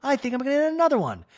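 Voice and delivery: high, fast, cheeky rebellious wife; mock high teen girl voice. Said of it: "And Kyle goes".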